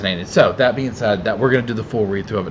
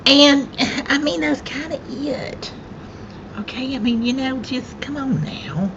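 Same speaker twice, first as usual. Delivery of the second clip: squeaky mock voice. Playful squeaky aside to chat.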